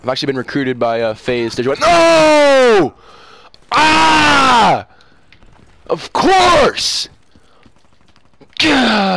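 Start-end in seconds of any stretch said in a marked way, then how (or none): 1.8-7.1 high, loud drawn-out yells; frustrated shout. Real, not acted. Gamer just got killed, frustrated screaming. 8.6-9.2 loud groan falling from high; frustrated "gah". Real, not acted. Gamer cursing their own mistake, loud exasperated groan. After being killed.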